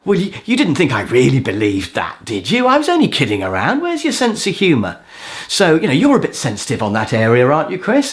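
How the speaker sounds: teasing, pitch swooping up on the questions; put-on disbelief, rising at the ends; mock-innocent protest. Games player brushing off Chris as too sensitive, mock-innocent. Acting out the game player's reply.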